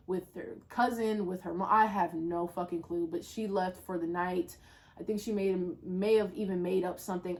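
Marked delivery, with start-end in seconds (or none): none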